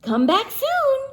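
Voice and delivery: high, sing-song giraffe goodbye; high cartoon giraffe voice; friendly giraffe. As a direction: call it after him like a tall giraffe. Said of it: "said the giraffe".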